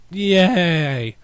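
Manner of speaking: high, loud fake "Yay!"; mock enthusiasm at a Gerard Butler ad; sarcastic cheer. Real, not acted. Mocking a movie ad.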